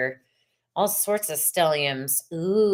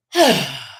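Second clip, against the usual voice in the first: big loud sigh; forceful exhale; 5 semitones lower, 6 dB louder than usual. Shown as a demonstration. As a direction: blow it all out in a sigh. Acts out exhaling after reading card.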